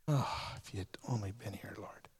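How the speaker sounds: very soft, low, hushed lament; a broken, quiet plea to the Lord; grieving sister. Acted. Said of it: "says those words".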